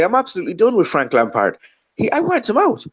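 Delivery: high-pitched Irish-sounding football rant impression; Roy Keane. Guest pretends to phone in about Lampard, high impersonated rant. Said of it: "I sound a bit like Roy Keane now".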